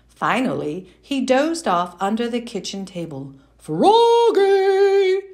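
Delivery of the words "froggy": loud, high call across the house; father calling his son loudly. Acted. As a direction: call him from far off. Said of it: "called his father".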